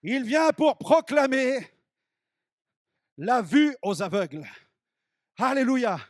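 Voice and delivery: loud, high, slow proclamation over music; full-force pulpit preaching. Acted. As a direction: thunder it out to the crowd.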